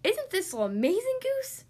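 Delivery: high, admiring duck voice; excited quack. A narrator's reading. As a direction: quack it eagerly, rising high at the end. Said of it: "Duck quacked".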